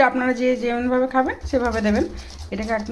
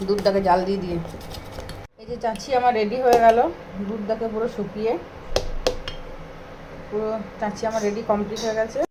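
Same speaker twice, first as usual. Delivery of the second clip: normal talking voice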